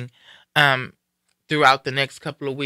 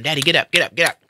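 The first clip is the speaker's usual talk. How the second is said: urgent calling out; 2 semitones higher, 5 dB louder, faster than usual. Retold or imitated. Shaking her dying father awake.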